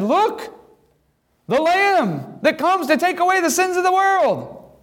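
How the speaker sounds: high-pitched prophetic announcement; proclaiming. Acted. John announcing the Lamb to all, proclaiming high. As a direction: call it out high, like a herald.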